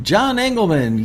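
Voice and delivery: high, slow, loud winner reveal; hyped giveaway announcement. Real, not acted. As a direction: announce the winner like a game-show host.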